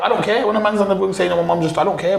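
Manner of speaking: high-pitched, impatient brush-off; dismissive outburst. Retold or imitated. Inmate on the wing dismissing another man's loss, high impatient retort. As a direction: imitate an inmate brushing off someone's troubles.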